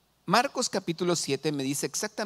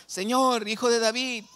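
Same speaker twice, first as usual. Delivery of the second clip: higher, insistent cry to the Lord; imitated shouting; 8 semitones higher, 3 dB louder than usual. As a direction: act out a woman shouting her plea, pitched well up. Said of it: "le estaba gritando".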